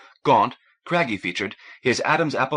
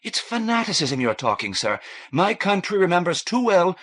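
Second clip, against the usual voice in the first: higher, anxious pleading; alarmed; 9 semitones higher than usual. A narrator's reading. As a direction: let alarm rise into the voice. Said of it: "Alarm tinged her voice".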